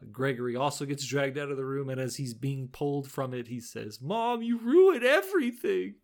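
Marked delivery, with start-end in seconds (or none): 4.0-5.9 high, loud, drawn-out complaint; hammed-up blaming of mom; mock tantrum. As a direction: wail it like a son being dragged away. Acting son dragged out, blaming mother.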